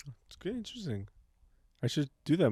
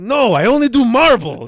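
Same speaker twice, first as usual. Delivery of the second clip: loud, high-pitched protest; hammed-up artistic tantrum; mock indignant refusal; 9 semitones higher, 13 dB louder than usual. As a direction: insist like a stubborn, offended artist. Voicing Michelangelo's stubborn refusal.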